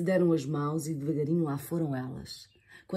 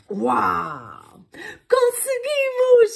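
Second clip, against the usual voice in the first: high, loud, slow triumph; high, loud, drawn-out victory cry; exclaiming; 6 dB louder, much slower than usual. Acted. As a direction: cheer at making it in time. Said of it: "exclamou a Eva".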